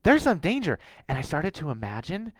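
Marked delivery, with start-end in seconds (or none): 0.0-0.8 high, loud alarm call; a sudden high loud warning; alarm of danger. Retold or imitated. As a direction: sound the inner alarm. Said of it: "this alarm of danger".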